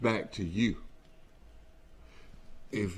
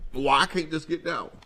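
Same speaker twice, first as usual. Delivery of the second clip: imagined driver's complaint; 7 semitones higher, 6 dB louder than usual. Retold or imitated. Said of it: "And you'll think".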